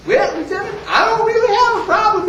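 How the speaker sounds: high-pitched feigned innocence; high, wheedling old-soldier voice; mock-innocent old soldier. Old soldier answers the lieutenant.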